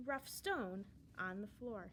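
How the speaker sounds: ordinary delivery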